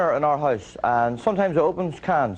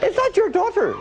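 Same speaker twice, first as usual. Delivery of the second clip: much higher, surprised-sounding question; eyebrows up. Shown as a demonstration. Comic showing the eyebrows-up way of asking. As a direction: lift the eyebrows and ask it high. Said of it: "eyebrows up".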